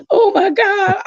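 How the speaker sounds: high squeal through real laughter; laughing. Real, not acted. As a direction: gasp it out while cracking up. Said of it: "I was rolling".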